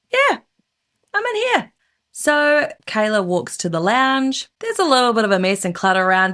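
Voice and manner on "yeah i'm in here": high-pitched answering call; calling back. Acted. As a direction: call back from another room, lightly acted. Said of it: "he yells out".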